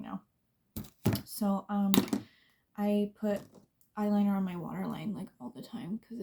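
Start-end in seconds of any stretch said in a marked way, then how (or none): none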